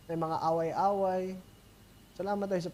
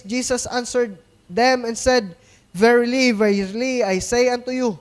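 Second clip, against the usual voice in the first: booming Bible reading from the pulpit; scripture reading; 3 semitones higher, 7 dB louder than usual. Acted. Reads scripture aloud.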